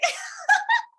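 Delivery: real laugh, high and loud; tickled by her own blunder. Real, not acted. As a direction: burst into a squealing laugh.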